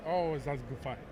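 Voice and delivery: normal talking voice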